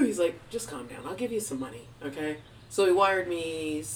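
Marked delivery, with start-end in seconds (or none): 0.5-2.4 reassuring dad. Retold or imitated. Father offering money to crying child, soft reassurance. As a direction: soothe them quietly.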